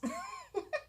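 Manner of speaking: a burst of high-pitched laughter; real amused giggle. Real, not acted. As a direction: laugh at how absurd it is. Laughing at the posh idea.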